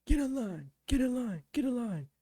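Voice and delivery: high, loud drill-instructor bark; instructor yelling. Retold or imitated. Instructor yelling at a recruit who can't walk straight, loud bark. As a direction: yell it like a drill instructor, each repeat just as hard. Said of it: "my M T I was yelling at him".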